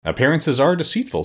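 earnest, high-pitched assertion. A narrator's reading. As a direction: state it with lofty conviction. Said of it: "said the Wogglebug earnestly".

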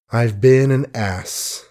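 ordinary delivery, nothing marked

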